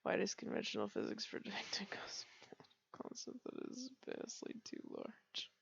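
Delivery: hushed unvoiced whisper, reading a physics question; a whispered take on Mutt's accent reading aloud. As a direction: whisper it like a timid Mutt. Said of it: "I'm going to do Mutt's accent".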